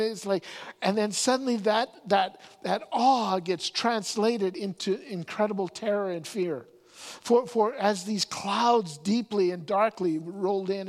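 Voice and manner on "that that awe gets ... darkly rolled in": ordinary talk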